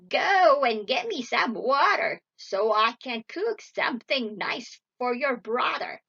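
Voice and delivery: loud, menacing witch voice; commanding witch. A narrator's reading. Witch sends Gretel for water, loud wicked orders. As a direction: order her about loudly and wickedly. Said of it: "she cried".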